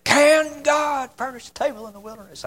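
hard, gravelly preacher's scream, high-pitched; screaming old-time preacher impression; Harold Sightler. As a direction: rasp it out like a hollering old preacher. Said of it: "He's got this little grovelly voice".

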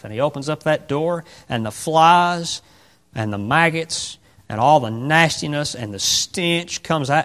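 ordinary delivery

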